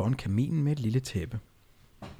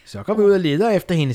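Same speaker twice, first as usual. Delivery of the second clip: high, louder witch call; 7 semitones higher, 7 dB louder than usual. Acted. Witch Dumme urges the others to search. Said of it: "sagde dumme".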